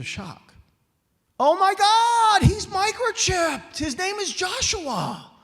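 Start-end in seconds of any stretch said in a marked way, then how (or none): 1.4-5.3 drawn-out, high shriek of disbelief; piercing, full-force shocked yell; shocked scream. Acted. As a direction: scream it out slowly at full pitch. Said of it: "you could hear the scream".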